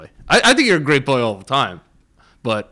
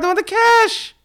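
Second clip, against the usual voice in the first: mock greedy kid. Mocking greedy nieces.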